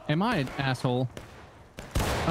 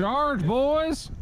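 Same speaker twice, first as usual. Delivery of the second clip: high, loud rallying order; officer's charge call; 14 semitones higher, 3 dB louder than usual. Pretend. Gamer playing officer, rallying his squad to charge. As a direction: rouse the troops like a captain.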